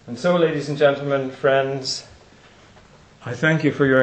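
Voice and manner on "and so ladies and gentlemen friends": ordinary talk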